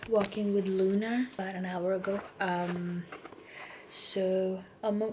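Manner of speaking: ordinary talk, nothing marked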